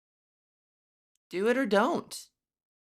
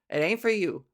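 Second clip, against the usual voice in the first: usual speaking voice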